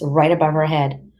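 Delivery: plain reading voice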